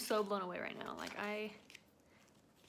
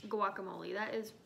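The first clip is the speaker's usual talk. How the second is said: ordinary talk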